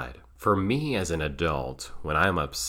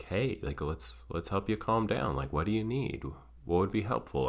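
softer and higher, kindly offering help; a caring friend, soft and a little higher; gentle support; 3 semitones higher, 6 dB quieter than usual. Retold or imitated. Supportive friend helping him calm down.

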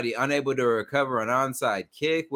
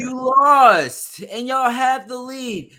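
high, loud, drawn-out protest; incredulous outrage; 10 semitones higher, 6 dB louder than usual. Real, not acted. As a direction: stretch it out high in disbelief. Mock outrage at friend's excuse.

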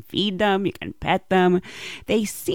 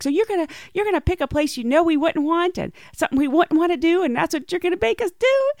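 fast, higher disbelieving quote; incredulous sister; 10 semitones higher, 4 dB louder, faster than usual. Retold or imitated. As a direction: rattle it off in mock outrage. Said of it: "She's like".

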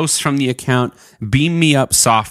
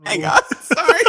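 laughing. Real, not acted. Laughing while trying to read.

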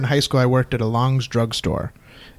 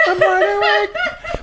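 very high, slow, laughing falsetto; silly high voice; much slower than usual. Pretend. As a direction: stretch it out way up high, giggling. Repeats line in silly voice.

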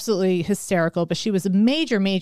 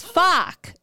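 imitated French-accented word, pitched higher; higher, mimicked French-Canadian accent; 7 semitones higher than usual. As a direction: repeat it in a mock French accent. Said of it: "French type of accent".

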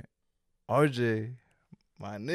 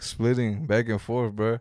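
usual speaking voice

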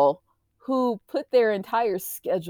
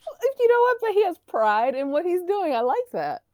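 excited; 11 semitones higher than usual. Real, not acted. Defending the posh commentator.

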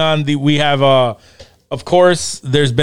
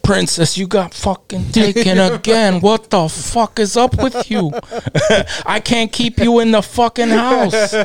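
higher-pitched comic scolding; exasperated Mario reader; 6 semitones higher than usual. Acted. Imagined actor as Mario scolding the princess, exasperated. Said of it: "some Italian would be reading the script".

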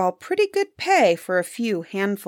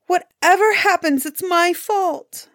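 high, aggrieved wail of self-pity; tearful complaint; 7 semitones higher, slower than usual. A narrator's reading. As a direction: play the wife tearfully blaming, pitched well up. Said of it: "the woman burst into tears".

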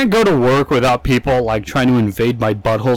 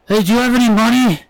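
high, loud ask for money; a stranger turning back to ask for cash; panhandler; 10 semitones higher, 4 dB louder than usual. Retold or imitated. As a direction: hit him up cheekily. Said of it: "turns around and goes".

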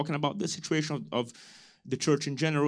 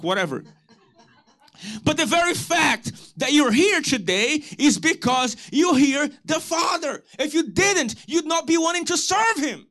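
preaching at full force; 13 semitones higher than usual. Acted. Pastor preaching to church, full-force rising shout. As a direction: drive it home to the congregation.

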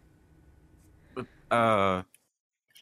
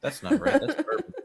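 surprised; 3 dB louder than usual. Real, not acted. Surprised at the wrong bottle.

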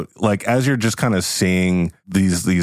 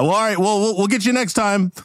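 singsong talk show host; much faster than usual. Acted. Host signing off.

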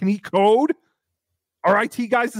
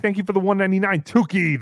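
ordinary talk, nothing marked

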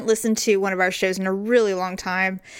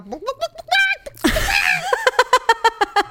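long, very high squawk; host imitating how she sounds when emotional, squawking; self-mocking squawk. Squawk high like a chicken. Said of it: "I feel like I sound like a".

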